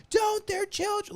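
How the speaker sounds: high-pitched mock scream; imitated mom screaming. Imitating the mom as officers pull guns, mock scream. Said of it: "you hear the mom actually screaming".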